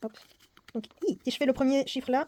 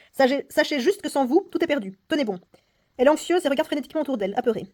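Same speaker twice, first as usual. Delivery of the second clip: louder, lively reading of the game text; story reading; 6 dB louder, faster than usual. Acted. Player reading the escape game story aloud, theatrical narration. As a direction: read the booklet like a dramatic tale.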